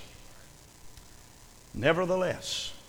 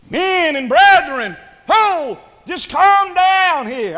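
loud, high, drawn-out call for calm; Caleb; 9 semitones higher, 10 dB louder, slower than usual. Acted. Caleb stilling the people before Moses, calling out loudly. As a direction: call out slowly over a noisy crowd.

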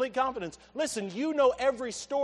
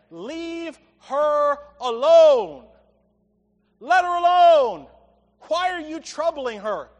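loud, slow preaching as Jesus rebuking; thundering pulpit rebuke; forceful rebuke; 3 semitones higher, 9 dB louder than usual. Acted. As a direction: boom it out in a stern defense. Preacher voicing Jesus rebuking disciples.

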